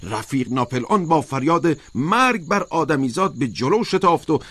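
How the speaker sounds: high, emphatic protest; insistent high-pitched oration, not a full shout; crying out. A narrator's reading. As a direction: argue it high and forcefully, like a rousing speech. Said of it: "اسکویلر فریاد کشید".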